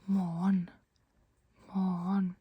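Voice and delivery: "morgen" shortened to mawn; Oslo dialect. Shown as a demonstration. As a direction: say "morgen" as one drawn syllable, no G. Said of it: "But in the Oslo dialect".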